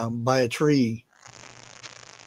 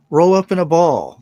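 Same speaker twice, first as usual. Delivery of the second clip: mock advice; 5 semitones higher, 10 dB louder than usual. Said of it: "that other one".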